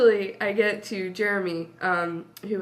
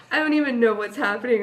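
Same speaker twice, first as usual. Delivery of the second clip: ordinary delivery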